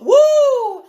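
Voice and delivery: high, loud "woo!"; laughing high whoop. Real, not acted. Excited whoop on the live.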